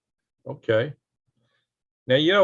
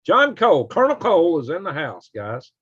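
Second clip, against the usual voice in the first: hearty greeting; 6 semitones higher, 5 dB louder than usual. Real, not acted. Greeting viewer.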